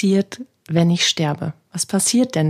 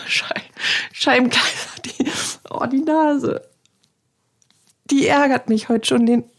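high, giggly curse at her itchy nose; laughing exasperation; 7 semitones higher than usual. Real, not acted. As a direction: laugh it off, pitch jumping higher with each word. Fighting an itchy nose mid-podcast. (lachend genervt, deutlich höher)

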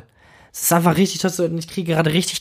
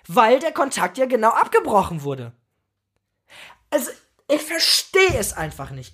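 high-pitched, heated outburst; angry rant; 9 semitones higher than usual. Real, not acted. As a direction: vent your frustration, pitched way up. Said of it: "Ich wollte dich nicht anschreien". (aufgebrachte, wütende Schimpftirade)

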